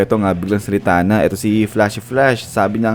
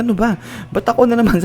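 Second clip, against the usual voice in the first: higher exasperated whine; mock outrage at being hit again; annoyed complaint; 9 semitones higher than usual. Saitama hit by rocks again, higher exasperated complaint. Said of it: "nagalit si Saitama".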